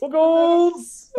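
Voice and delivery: squealed hello to a friend; high excited shout. Real, not acted. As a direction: yell it out with glee. Shouted greeting.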